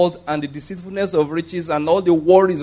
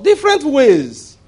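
emphatic answer; 9 semitones higher, 6 dB louder, slower than usual. Real, not acted. Preacher answers his own question.

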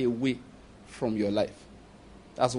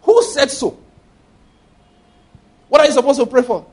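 high, loud rhetorical demand; indignant challenge; 7 semitones higher, 6 dB louder than usual. Real, not acted. Preacher rebuking the congregation, loud and indignant. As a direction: demand an answer from the crowd.

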